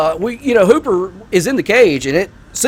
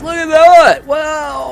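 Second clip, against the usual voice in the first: excited shout; 11 semitones higher, 4 dB louder, slower than usual. Real, not acted. Sudden shock at something in game.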